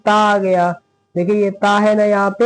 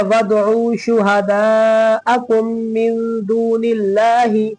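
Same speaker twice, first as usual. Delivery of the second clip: slow, drawn-out chanted recitation; Quran recitation; 2 semitones higher, much slower than usual. Shown as a demonstration. Teacher reciting for students, slow and melodic. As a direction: recite slowly, stretching each vowel.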